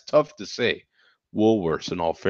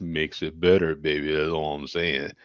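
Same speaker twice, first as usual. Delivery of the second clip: low, softer put-on voice; low, playful character shtick; put-on "baby" voice; 8 semitones lower, 7 dB quieter than usual. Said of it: "You do the voice".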